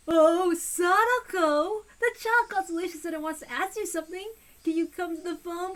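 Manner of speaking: syrupy fake-kind call downstairs; sweet phony voice. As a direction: coax her with fake sweetness. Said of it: "in a sweet, phony voice".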